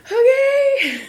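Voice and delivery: high, squeaky laughing words. Real, not acted. Mocking her friend's roller coaster statistics, laughing high.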